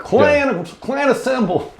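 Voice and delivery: very high, hammed-up hillbilly call to arms; mock rallying cry.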